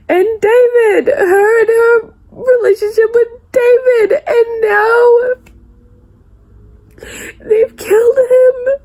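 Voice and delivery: real tears, voice high and breaking; crying. Real, not acted. Grieving a killed character.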